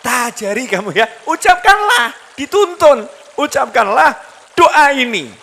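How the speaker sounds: preacher at full force; fervent teaching. Acted. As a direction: call out the Prophet's words with fervour. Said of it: "Nabi Muhammad ... istrinya diajarin".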